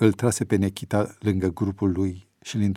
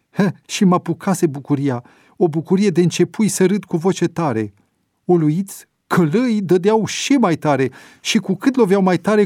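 high, loud, swept up in joy; enthusiastic retelling; 8 semitones higher, 5 dB louder than usual. A narrator's reading. Prisoner telling fellow inmates of the beating, high and joyful. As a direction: tell the miracle with rising delight. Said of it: "Ieremia zicea cu entuziasm".

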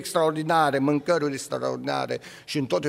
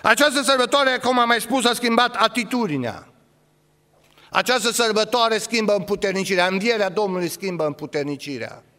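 loud, higher insistent preaching; fiery preaching; 8 semitones higher, 4 dB louder than usual. Acted. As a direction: hammer it home to the church. Preacher repeating with force.

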